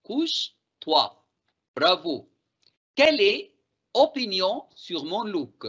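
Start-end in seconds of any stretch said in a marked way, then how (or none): 0.0-1.0 couche-toi said slowly and stretched out; slow model phrase. Shown as a demonstration. Teacher models the affirmative form slowly for students. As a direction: drawl couche-toi for the class to repeat. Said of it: "à la forme affirmative".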